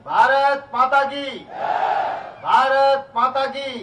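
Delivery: drawn-out, full-throated slogan cry; rally slogan shout. Real, not acted. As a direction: call it out to rouse the crowd. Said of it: "जोर से लगाएंगे".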